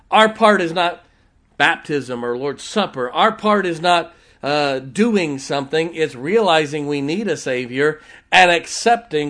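loud, emphatic preacher voice; forceful preaching. Acted. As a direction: hammer out each point to the congregation.

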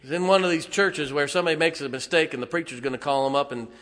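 loud, high preaching about tough men; rising pulpit shout; fiery preaching. Real, not acted. Preaching at full force.

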